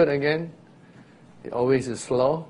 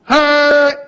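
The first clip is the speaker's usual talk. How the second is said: much higher, loud startled huh; startled listener; puzzled huh; 12 semitones higher, 10 dB louder than usual. Retold or imitated. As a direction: react like someone who barely caught a sound. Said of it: "the natural ears will hear".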